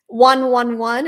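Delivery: ordinary talk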